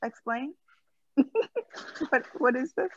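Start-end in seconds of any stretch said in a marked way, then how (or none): none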